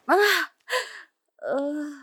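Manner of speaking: sharp, high, loud yelp, then groan; pained cry. Acted. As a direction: cry out, then let it ease. Cries out at pain.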